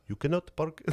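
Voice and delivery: hint of a high Indian-accented parking officer; mock Indian accent. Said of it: "Indian dudes. ... They're like".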